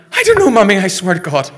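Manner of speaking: high, loud protest; frantic denial. Acted. Son denying blame to his ma, frantic. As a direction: blurt your innocence at the top of your voice.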